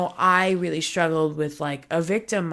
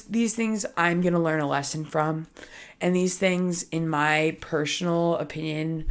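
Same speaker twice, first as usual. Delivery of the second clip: normal talking voice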